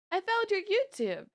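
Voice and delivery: put-on high little-girl voice; imitating niece. Niece telling her aunt she found her channel, high girlish voice. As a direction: play the niece, bright and high.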